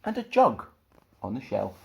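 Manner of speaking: put-on lively read-aloud to children; children's story reading. A narrator's reading. Storyteller reading a picture book to children, lively and bright. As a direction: tell it with big storybook energy.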